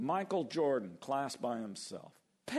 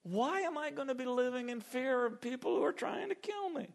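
much higher, wondering defiance; David scorning those who want him dead, in a higher defiant voice; 14 semitones higher than usual. Retold or imitated. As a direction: scoff at fear as David. David defies his enemies.

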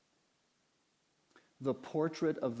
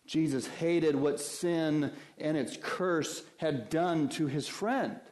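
ordinary delivery

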